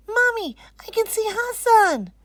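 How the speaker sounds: put-on high and loud, thrilled child; excited shout. Anisa excitedly telling Mommy she sees Hassan, loud. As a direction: yell the news to Mommy. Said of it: "Anisa shouts".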